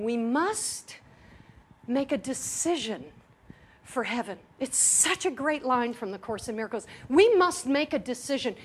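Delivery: ordinary talk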